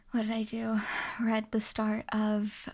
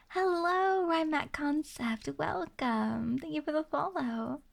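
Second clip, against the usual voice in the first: ordinary talk, nothing marked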